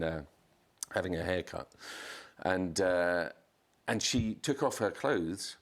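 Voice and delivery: usual speaking voice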